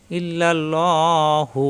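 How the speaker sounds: ইল্লাল্লাহু with a full, heavy ল and slow drawn end. Shown as a demonstration. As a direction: round the lam in ইল্লাল্লাহু. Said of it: "এই আল্লাহ শব্দের ডানে জবর আসায় ল হবে". (ইল্লাল্লাহু ভারী লামে টেনে)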